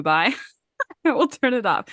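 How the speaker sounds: normal talking voice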